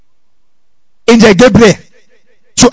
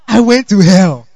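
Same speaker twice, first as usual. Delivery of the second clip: mild imitation of crying testimony; acted tearful testimony. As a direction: mimic a crying sister testifying. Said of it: "And she's crying".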